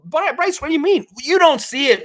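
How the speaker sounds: high, loud reading of a viewer's protest; protesting commenter. Retold or imitated. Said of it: "Milbester saying".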